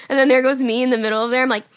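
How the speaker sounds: ordinary talk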